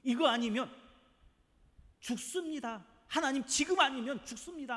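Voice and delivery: high, loud pleading cry; a pleading people crying out to God; desperate crying out. Acted. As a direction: beg for your life in prayer. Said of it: "간절히 부르짖었던".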